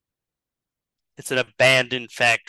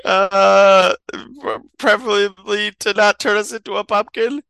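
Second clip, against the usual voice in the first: high, hesitant, stammered answer; mock nervous; 7 semitones higher, 4 dB louder than usual. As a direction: stammer a wary plea in a raised pitch. Nervous captives answer the villain.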